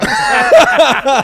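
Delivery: high, loud cackle; shrieking laugh at himself; loud burst of laughter. Real, not acted.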